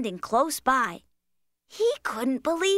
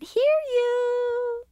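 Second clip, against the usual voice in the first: calling out; 9 semitones higher than usual. Acted. Calling to Pole who fell asleep.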